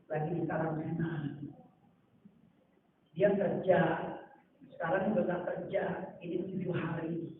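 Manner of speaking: soft, subdued plea of a man in need; quiet needy guest. Retold or imitated. A guest asking the preacher for help, soft and subdued. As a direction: speak low like someone ashamed to ask.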